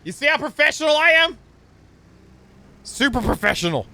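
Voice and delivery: high, loud self-mocking boast; proudly overblown, tongue in cheek; mock bragging. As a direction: crow it like a goofy show-off.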